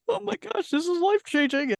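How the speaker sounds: very high, strained pretend sob of being overwhelmed; mock teary gushing. Host pictures herself crying in class, hammed-up high tearful gush. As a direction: ham up a tearful gym newbie. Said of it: "one of those being like".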